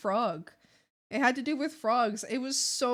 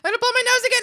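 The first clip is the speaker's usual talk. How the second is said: much higher and louder; loud squealy announcement; 12 dB louder, much faster than usual. Real, not acted. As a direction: shout it out gleefully.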